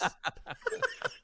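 high-pitched giggle, quiet; a tiny squeal of a laugh; squeaky high laugh. Real, not acted. Laughing at his own AI-voice joke, a squeaky giggle.

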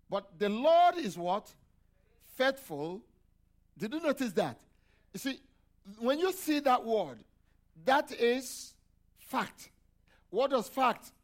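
loud, slow call-and-response preaching; preaching at full force. Acted. Preacher raising voice to congregation.

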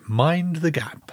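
slow, soft, reserved announcer; stiff upper lip. Acted. Co-host imitating the recorded announcer, stiff upper lip. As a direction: say it slowly and quietly, all British reserve. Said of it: "a very stiff upper lip kind of fellow".

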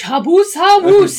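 high, loud, drawn-out gibberish cry; loud high-pitched goblin nonsense; mock Goblin speech. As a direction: belt out pretend Goblin slowly and high. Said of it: "Zev speaking in Goblin".

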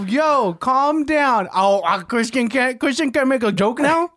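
high, loud, drawn-out mock protest. Defending a joke to the co-hosts, mocking put-on voice. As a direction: put on an indignant high voice, stretching the words. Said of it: "wish you wouldn't make that voice".

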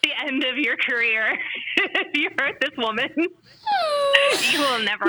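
laughing through the words; breaking into real laughter mid-sentence. Real, not acted. Laughing about hurting Kathy Bates.

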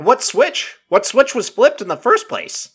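mocking disbelief at the excuse; incredulous. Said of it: "I'm like".

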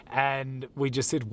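ordinary talk

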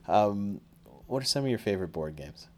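usual speaking voice

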